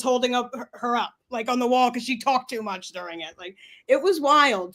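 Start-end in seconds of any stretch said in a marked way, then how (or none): none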